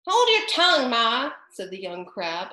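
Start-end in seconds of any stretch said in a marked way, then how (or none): 0.1-1.3 high, loud snappish retort. A narrator's reading. Young crab snapping at her mother, snappish. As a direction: snap back at mother. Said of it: "a little snappishly".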